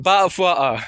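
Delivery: imitated British 'bottle of water', t's dropped; British accent. Vlogger mimicking the British saying she dislikes, mock British accent. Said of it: "I don't like the British saying".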